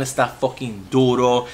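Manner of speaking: usual speaking voice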